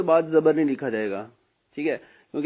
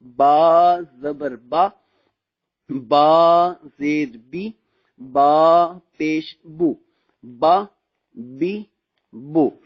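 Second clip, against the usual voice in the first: slow and louder, each "बा" held long; long drill syllables; 5 dB louder, much slower than usual. Shown as a demonstration. Teacher drills students slowly and loudly. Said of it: "इसको कैसे पढ़ना है".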